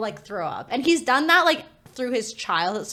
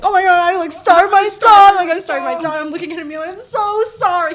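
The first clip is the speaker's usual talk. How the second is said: high, loud, hammed-up panic; mock panicked sobbing; 8 semitones higher, 10 dB louder than usual. Owner apologising to the starved dog, mock panicked sobbing. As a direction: wail a frantic apology, playing up the guilt. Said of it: "panicking, like, sobbing".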